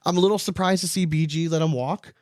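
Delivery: normal talking voice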